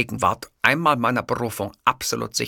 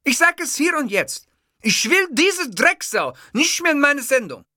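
high, loud angry declaration; furious ultimatum; 4 dB louder than usual. Acted. Host furiously banning the guest from his show. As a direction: lay down the law in rage.

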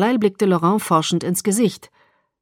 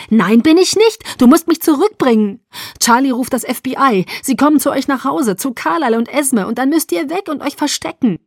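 high, urgent pleading; agitated arguing; 6 semitones higher, 4 dB louder than usual. A narrator's reading. Bella insists Edward must bring her back, agitated. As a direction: argue back with raised pitch, pressing each reason.

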